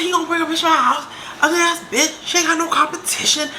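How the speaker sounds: fast, high indignant outburst; retold arguing. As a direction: fire off the insult like mid-argument. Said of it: "I'm arguing with Motivation".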